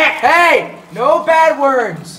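loud scolding. Pretend. Scolding friend for swearing, loud drawn-out reprimand. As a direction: call out a loud, slow telling-off.

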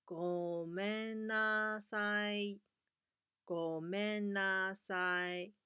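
slow, careful ごめんなさい; teacher modelling the apology; slow model gomennasai. Shown as a demonstration. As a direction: say ごめんなさい slowly for the class. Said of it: "用这句话".

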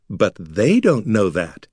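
slow down and lean on "they"; stress on they. A narrator's reading. Wizard telling Artek the trick, stressing they. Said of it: "contrasting stress".

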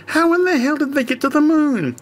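high, baffled squirrel voice; a squirrel who can't believe it. Acted. Squirrel stranger baffled by the moon story, high squeaky voice. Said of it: "The squirrel sounded baffled".

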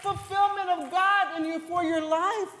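shouted, high preacher's climax; full-throated altar call; preaching at full force. Acted. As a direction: drive it home to the congregation.

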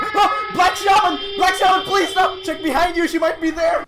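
high-pitched screaming plea; mock terror. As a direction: shriek in hammed-up panic.

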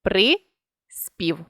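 При-спів said as two separate syllables; teacher dictating syllables; split into syllables. Shown as a demonstration. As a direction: pause between При and спів. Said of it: "Не відривається один приголосний від кореня".

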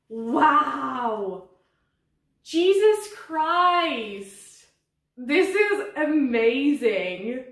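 slow, stretched-out wonder, rising high and loud; amazed. Real, not acted. Unwrapping the second surprise plant, amazed. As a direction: draw every word out in astonishment.

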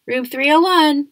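loud call from another room. A narrator's reading. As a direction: call it out from far away. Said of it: "called out from the other room".

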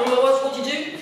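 normal talking voice

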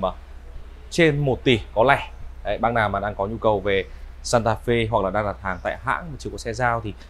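ordinary talk, nothing marked

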